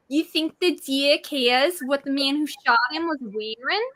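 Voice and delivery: slow New Jersey movie-quote voice; New Jersey accent. Shown as a demonstration. Guest quotes My Cousin Vinny in a New Jersey accent. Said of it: "I sound like".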